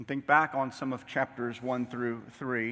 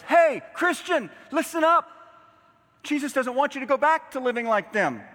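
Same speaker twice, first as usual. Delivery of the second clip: loud, high calling out; preacher voicing an insistent warning; urgent exhortation; 12 semitones higher, 7 dB louder than usual. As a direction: shout to get a believer's attention. Preacher voices Paul calling out to Christians.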